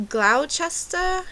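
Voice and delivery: "Gloucester" slow and high, like a question; unsure pronunciation. Retold or imitated. Fake Brit bluffing a hometown, "Gloucester" said slowly as a question. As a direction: sound out "Gloucester" as a loud, tentative guess. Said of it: "said it the best that I could".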